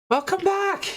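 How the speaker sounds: high, bright greeting of a barmaid; bubbly barmaid. Acted. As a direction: sing out a welcome as the barmaid. Barkeeper Heather greets players.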